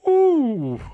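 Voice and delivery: high, drawn-out "ooh" of sympathy; wincing ooh. Real, not acted. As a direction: let out a long, high ooh. Reacting to heat in biking leathers.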